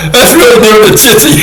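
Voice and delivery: high laughing voice. Real, not acted. Amused by the fleeing soldiers, laughing high. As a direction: laugh the words out.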